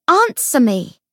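loud commanding cry; shouting. Acted. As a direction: yell the order at the ghost. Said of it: "Lucy cried".

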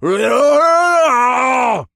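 long, high battle cry; wordless roar while charging; furious shout. A narrator's reading. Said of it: "with a furious shout".

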